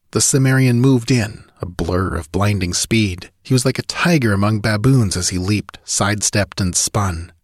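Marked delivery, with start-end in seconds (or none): none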